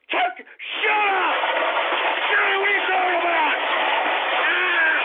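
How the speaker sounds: high, loud, cut-off screaming; furious scream. Real, not acted. Streamer reacting to a troll name, furious scream. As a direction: explode at the troll.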